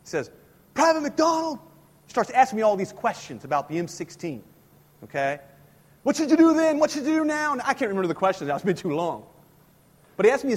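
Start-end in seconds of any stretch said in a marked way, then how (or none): 0.7-1.6 drill sergeant voice. Retold or imitated. Drill sergeant addressing recruit. 6.0-7.5 drill sergeant quizzing. Retold or imitated.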